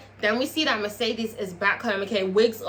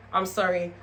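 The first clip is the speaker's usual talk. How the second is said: ordinary talk, nothing marked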